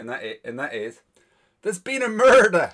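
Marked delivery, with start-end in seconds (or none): 1.6-2.7 loud, high, hammed-up murder announcement; dramatic catchphrase. Co-host feeds the Scottish catchphrase, hammed up loud. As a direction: proclaim it like a TV detective. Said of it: "I've got one phrase to say".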